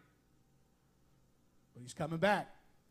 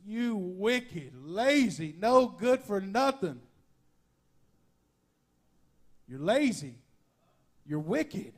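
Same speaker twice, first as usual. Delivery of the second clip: louder, slow scolding of the lazy servant; angry rebuke; 4 dB louder, much slower than usual. Retold or imitated. Master scolds lazy servant, loud, slow, disappointed. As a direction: berate him with slow, heavy disappointment. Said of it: "I could hear the anger and the disappointment".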